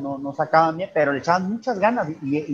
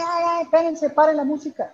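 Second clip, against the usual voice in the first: retold shout; 12 semitones higher than usual. Young man alerting the whole party to stop the music, mock yell. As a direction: imitate someone calling a halt to a party. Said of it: "les gritó a todos".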